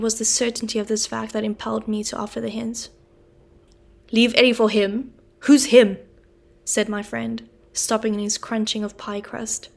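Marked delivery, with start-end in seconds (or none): 4.1-6.0 sudden loud startled question. Acted. Convict startled by the boy's mention, loud alarm.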